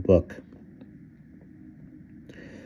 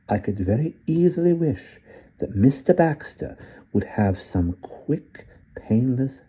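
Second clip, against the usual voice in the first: put-on higher, unhurried old Englishman with polite menace; sly old gentleman suggesting murder; 8 semitones higher than usual. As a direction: purr the murder idea casually, like a gentleman villain.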